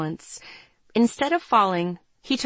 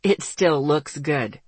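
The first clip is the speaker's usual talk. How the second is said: low, slow male reassurance; reassuring; 5 semitones lower, slower than usual. A narrator's reading. Boy reassuring her about the haircut, low and slow. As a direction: reassure her in a lower, slower voice. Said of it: "he reassured me".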